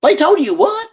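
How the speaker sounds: high, loud incredulous question; shocked volunteer asking him to repeat; surprised volunteer. Retold or imitated. Red Cross lady hearing the soldiers' claim, surprised.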